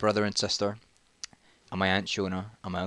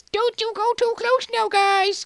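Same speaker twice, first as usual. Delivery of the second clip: very high, shrieky mock mum voice; mum impression; 5 dB louder, slower than usual. Mum warning kids near the rapids, very high caricature. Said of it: "'Cause that's how my mum talks".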